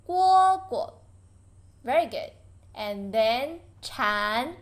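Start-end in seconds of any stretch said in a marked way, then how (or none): 0.1-0.9 蝈蝈 higher and louder, second 蝈 short and unstressed in light tone. Shown as a demonstration. Teacher modelling light tone for students, second 蝈 unstressed. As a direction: say 蝈蝈 high and loud, letting the second 蝈 fall away light. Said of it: "Light tone".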